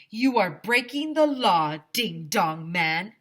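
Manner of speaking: loud, stern principal yell; shouting through a bullhorn. Acted. Principal yelling at the ice cream man through a bullhorn. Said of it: "he shouted through the bullhorn".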